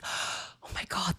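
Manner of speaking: slow, unvoiced-sounding awed whisper; imitated awestruck girl. Young girl admiring how she stood up to him, awed whisper. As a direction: whisper it in amazement. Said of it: "and she's like".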